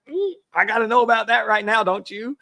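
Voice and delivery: high, loud gushing over a delivery; mock excitement. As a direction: squeal like a kid at a parcel. Mock excitement over Amazon notification.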